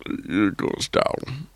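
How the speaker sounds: Connery dip. Shown as a demonstration. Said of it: "he also has that".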